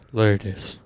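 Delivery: deep, low seducer's voice. Retold or imitated. Imitating buddy greeting the women, deep smooth croon. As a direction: drop it low like a soul crooner.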